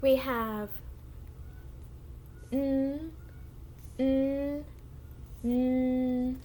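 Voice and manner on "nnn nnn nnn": N as three separate held nasal hums; letter sound. Shown as a demonstration. Teacher models the sound for the class, letter sound. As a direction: hum N as nnn, three times with pauses. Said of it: "say the sound three times".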